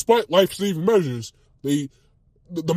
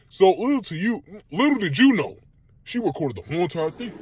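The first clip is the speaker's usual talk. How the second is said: ordinary talk, nothing marked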